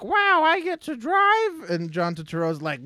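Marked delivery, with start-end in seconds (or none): 0.0-1.7 put-on excited kid voice. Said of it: "the kid's like".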